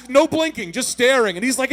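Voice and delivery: ordinary talk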